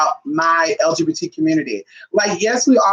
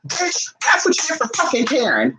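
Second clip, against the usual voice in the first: self scolding; 6 semitones higher than usual. Retold or imitated. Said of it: "reading myself down".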